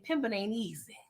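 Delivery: soft unvoiced joke; whispered aside. Real, not acted. Asking viewers for likes, a whispered joking aside. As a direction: breathe it out like a secret.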